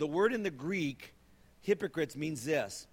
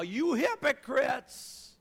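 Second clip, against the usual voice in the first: slow, higher shouted rebuke; angry shout; 10 semitones higher, 7 dB louder, much slower than usual. Acted. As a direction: hurl it at them. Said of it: "he's ticked now".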